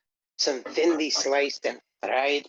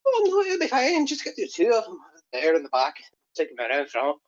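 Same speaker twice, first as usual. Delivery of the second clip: mock Irish reassurance; 3 semitones higher than usual. Voicing game characters in Irish.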